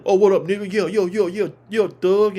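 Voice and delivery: hammed-up street slang. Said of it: "We ain't gotta".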